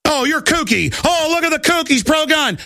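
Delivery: high, jeering mock alarm; mocking name-callers. Host mimicking those who call gun supporters kooks, high jeering mockery. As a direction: mimic scoffers pointing out a kook. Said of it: "they call them kooks".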